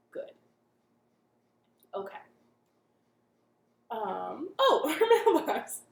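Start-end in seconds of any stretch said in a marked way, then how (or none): 4.6-5.8 higher, louder laughing oh; laughing surprise. Real, not acted. Host startled by the mailbox, laughing.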